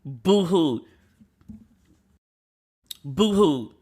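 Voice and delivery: fake-cry it; theatrical boo-hoo; mock sob. Crying over the show.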